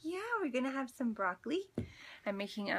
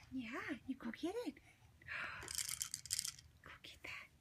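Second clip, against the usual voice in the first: soft coaxing whisper; 4 semitones higher, 10 dB quieter than usual. Real, not acted. Coaxing baby quietly.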